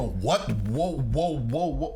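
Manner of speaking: reenacted startled reaction, pitch lifting; mock alarm in a quick string of whoas; visceral shock. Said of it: "this visceral reaction".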